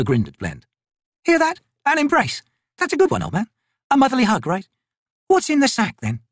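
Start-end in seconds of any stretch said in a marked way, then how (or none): 1.2-6.2 high-pitched, wry soldier voice; mocking corporal. Corporal jokes to her comrade about the trader, mocking. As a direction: tease the old man, then question him.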